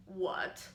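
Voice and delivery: "what" dipped low in the voice; low, dipping "what"; third tone. Shown as a demonstration. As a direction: sink "what" down in a third-tone dip. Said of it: "put on the four syllables for what".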